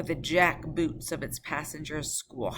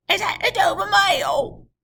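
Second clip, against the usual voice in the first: much higher, loud call; calling from the mist; 11 dB louder than usual. Acted. Rider calling to the coach, high and loud. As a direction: call from far off. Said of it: "a man's voice called from the mist".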